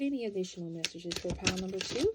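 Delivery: normal talking voice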